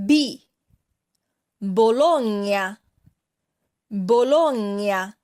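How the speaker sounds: slow, word-by-word model reading with long pauses and each stressed syllable punched, as in anCOna, boLOgna; stressed syllables demonstrated. Shown as a demonstration. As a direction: read it out for learners to repeat, leaning on each stressed syllable. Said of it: "注意每个单词的重音".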